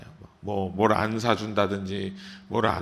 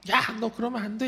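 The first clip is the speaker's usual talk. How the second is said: higher-pitched mock scolding of a child; scolding parent; 9 semitones higher than usual. Parent telling a misbehaving child no, mock scolding. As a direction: imitate an annoyed parent telling a kid off. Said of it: "왜 이렇게 부모가 화를 내냐는".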